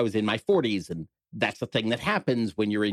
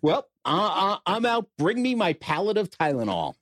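higher, hammed-up sore guy voice; achy middle-aged man; 8 semitones higher than usual. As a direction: play a worn-out patient, pitched up.

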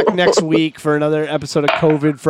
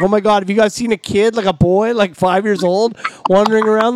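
higher, urgent mock-alarmed plea; fake worried; 6 semitones higher than usual. Pranking golfers from the cart, higher, fake panicked search for a kid. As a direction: pretend you've lost a child, anxious and earnest. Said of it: "I looked at them and all serious".

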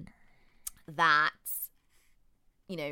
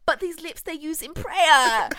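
mock Juliet voice; 9 semitones higher, 5 dB louder than usual. Juliet voiced, raised pitch.

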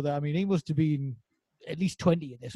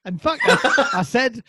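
ordinary delivery

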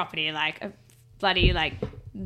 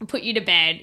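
ordinary talk, nothing marked

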